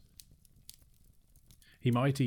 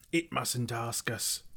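high, strangled creature shriek; drawn-out creature screech; shrieking Gollum; 4 semitones higher, slower than usual. A narrator's reading. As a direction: screech it slowly in distress. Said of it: "shrieked Gollum".

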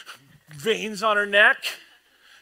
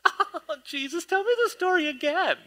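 much higher, breathy-free doting gush; swooning Mary; 11 semitones higher, 4 dB quieter than usual. Retold or imitated. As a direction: play the starstruck sister. Mocking Mary's adoration.

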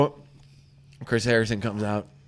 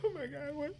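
tiny, high, hushed gasp of shock; stunned disbelief; 13 semitones higher, 16 dB quieter than usual. Acted. Bride seeing the wrong man come out, hushed high shock. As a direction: go small and high, barely voiced. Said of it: "So she's like".

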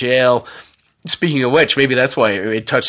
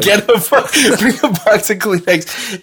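laughing; 7 semitones higher than usual. Real, not acted. Laughing repeat of joke.

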